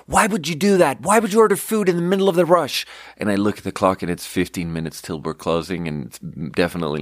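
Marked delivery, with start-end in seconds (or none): 0.1-2.9 high-pitched mock scream of outrage; retold scream. Retelling a coworker's outburst over a food order, mock scream. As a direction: imitate a coworker screeching in exasperation. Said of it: "Lilith screamed at me".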